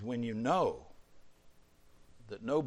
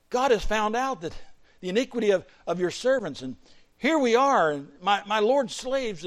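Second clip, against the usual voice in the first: loud, raised-pitch plea; urgent confession; 9 semitones higher, 8 dB louder than usual. Acted. Preacher voices Judah's desperate confession.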